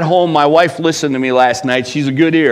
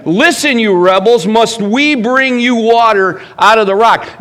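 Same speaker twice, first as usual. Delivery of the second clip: raised, loud scolding of the rebels; angry rebuke; 8 semitones higher, 5 dB louder than usual. Retold or imitated. Moses rebuking the rebellious people, loud and angry. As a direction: rebuke them hotly, voice up high. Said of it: "The shepherd was angry and called the sheep rebels".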